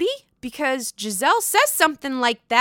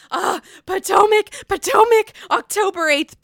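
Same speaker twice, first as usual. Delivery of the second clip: high, loud excited squeal of the show's name; 9 semitones higher, 6 dB louder than usual. Real, not acted. Fan hyping the new season trailer, excited shout. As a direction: cheer it out like a fan who has been waiting. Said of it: "I am so fucking excited".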